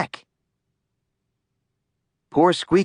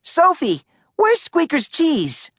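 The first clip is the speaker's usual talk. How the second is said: urgent. A narrator's reading. Arthur has an idea.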